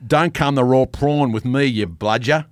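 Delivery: loud, broad native Aussie; punchy Aussie ticking-off; Australian accent. Shown as a demonstration. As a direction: demonstrate the real Australian accent. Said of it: "That's how it is".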